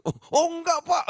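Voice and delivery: high, loud startled protest; flustered denial. Retold or imitated. Mother startled by the pastor's joke, high flustered denial. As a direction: protest fast and flustered, caught off guard.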